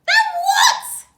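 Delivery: very high, loud shrieked question; scream. Acted. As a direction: screech in disbelief. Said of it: "screamed Missus Twit".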